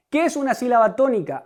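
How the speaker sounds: ordinary talk, nothing marked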